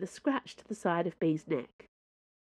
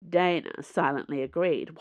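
plain reading voice

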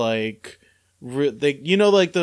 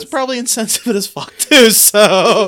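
laughing; 7 semitones higher, 9 dB louder than usual. Real, not acted. Laughing at own accent.

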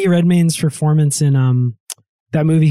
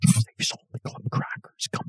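whispered secret; hushed conspiratorial whisper; 10 dB quieter than usual. Acted. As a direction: breathe it like a dark secret. Whispered announcement.

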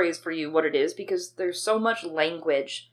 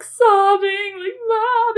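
high, loud mock wail, drawn out; hammed-up sobbing for mom; imitated sobbing; 8 dB louder, much slower than usual. Imitating self as a drugged teen crying to mom, mock sobbing. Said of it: "I remember crying and".

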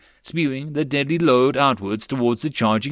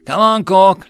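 loud, high urging shout; pressing battlefield yell; urgent cry; 9 semitones higher, 10 dB louder than usual. A narrator's reading. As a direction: cry out to hurry a buddy along. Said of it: "jerked his arm and cried".

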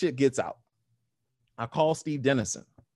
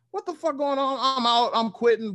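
flipping out; 10 semitones higher than usual. Retold or imitated. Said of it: "I flip out".

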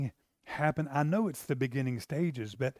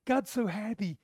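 much higher and louder, bubbling with joy; rejoicing angels; 8 semitones higher, 3 dB louder than usual. Retold or imitated. Angels rejoicing over a repentant sinner, high gushing excitement. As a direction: gush like angels who can't contain their delight. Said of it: "They're going".

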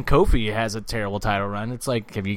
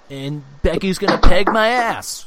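loud, high-pitched nasally parody; Seth Rollins impression; 7 semitones higher, 6 dB louder than usual. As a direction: send up a wrestler's promo, pushed high and loud. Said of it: "I kinda have a nasally voice".

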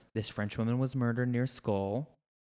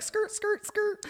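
much higher, squealing skirt skirt skirt like tires; tire screech; 4 dB louder, much slower than usual. Retold or imitated. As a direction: mimic the car skidding round corners. Tires screeching round narrow corners.

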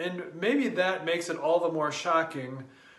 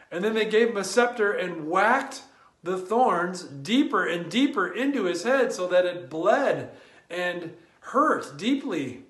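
usual speaking voice

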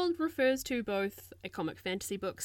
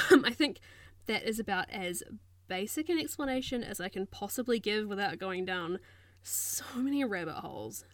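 ordinary talk

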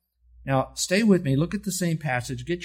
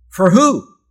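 1 loud question; 6 semitones higher, 13 dB louder than usual. Real, not acted. Preacher's rhetorical question to congregation.

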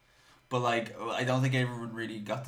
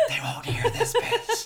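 mock whisper; 4 dB louder, slower than usual. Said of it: "how you whispered at me".

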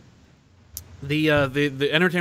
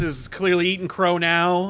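ordinary delivery

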